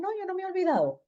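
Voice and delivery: high, quick, soft reply; light high-pitched retort, imitated; matching reply. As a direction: answer back quickly, pitched well up. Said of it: "yo le respondí a la misma manera".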